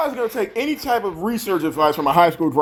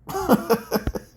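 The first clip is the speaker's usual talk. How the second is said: mocking laugh; 7 semitones higher, 4 dB quieter than usual. Real, not acted. Laughs at heavy breathing.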